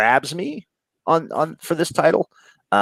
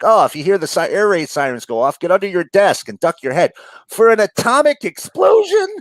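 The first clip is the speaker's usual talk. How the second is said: high-pitched parody of a safety drill; mock instruction; 7 semitones higher, 4 dB louder than usual. Host mocks duck-and-cover teachers advising schoolchildren, hammed-up instruction. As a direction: build to a louder, incredulous finish. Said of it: "They were teaching people, like".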